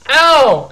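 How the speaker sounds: high, loud "ow" of pain; pained yelp. Real, not acted. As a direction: yelp as your hand gets hurt. Puppet hurts the speaker's hand.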